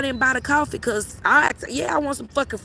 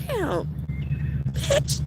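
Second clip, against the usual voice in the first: mock amazed; 5 semitones lower, 6 dB quieter than usual. Reacting to friend's happiness, mock amazed. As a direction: let bitch jump up in awe. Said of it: "Her happy will make you be like".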